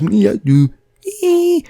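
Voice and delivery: loud high grunt; grunting bloke. Retold or imitated. Mate grunting at Barry, loud and high. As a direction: grunt it out wordlessly. Mate who can't speak English.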